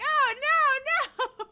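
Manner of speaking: loud laughing cry of oh no, pitched high. Retold or imitated. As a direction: burst out laughing while yelling no. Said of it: "she was like".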